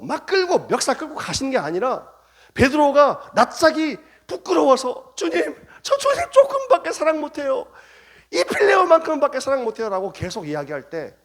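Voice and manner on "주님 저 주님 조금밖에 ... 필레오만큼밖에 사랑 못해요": high, laughing, sheepish pleading; ashamed Peter. Acted. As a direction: squirm with embarrassment as Peter. 부끄러운 베드로 연기. (부끄러워 웃으며 하는 변명)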